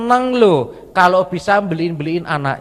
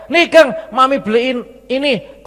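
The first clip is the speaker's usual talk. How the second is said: higher-pitched mom voice; mother offering food; 8 semitones higher than usual. Retold or imitated.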